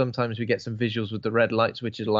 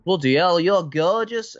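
higher, louder Scottish exclamation; amateur Scottish accent, taken aback and admiring; attempted Scottish accent; 7 semitones higher, 5 dB louder, slower than usual. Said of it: "attempt to do the bloody Scottish accent".

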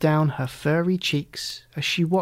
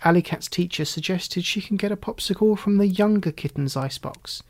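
ordinary delivery

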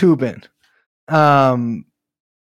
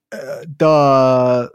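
dopey "duh"; dumb clown voice. Retold or imitated. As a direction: play it dumb and slow. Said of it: "I would just be like".

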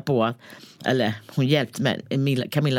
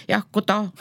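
put-on higher Tornedalen-accented greeting; 6 semitones higher than usual. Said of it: "Nu blir det tornedalska".

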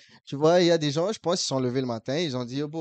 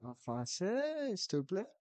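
demande appuyée; 4 semitones higher, 9 dB quieter, slower than usual. Retold or imitated. Le client exige le français.

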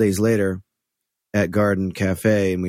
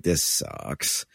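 muttered under breath; 6 semitones lower, 7 dB quieter than usual. Retold or imitated. Toes curl, quiet groan.

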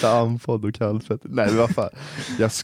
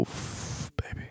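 breathy whisper; 16 dB quieter, much slower than usual. Retold or imitated. Imagined turned-on reaction.

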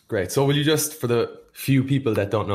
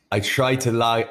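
ordinary delivery

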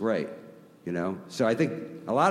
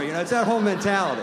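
normal talking voice

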